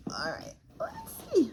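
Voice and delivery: hushed whisper to herself. Real, not acted. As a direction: breathe it, barely voiced. Hushed while opening the box.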